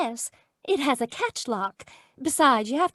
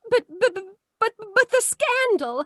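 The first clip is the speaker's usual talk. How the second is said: flustered panic; 7 semitones higher than usual. Acted. Teacher fears scandal.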